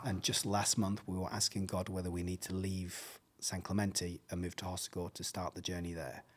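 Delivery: soft, low, heartfelt telling; hushed retold confession. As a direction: confide it quietly, as if deeply moved. Pastor's tearful confession continues.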